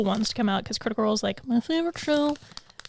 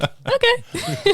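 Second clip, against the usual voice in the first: high sing-song okay; 12 semitones higher than usual. Retold or imitated. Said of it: "So we were like".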